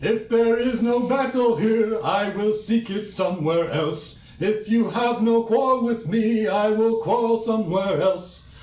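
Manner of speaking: high, slow, grand warrior voice; booming warrior challenge. Acted. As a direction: proclaim it like a proud duelist.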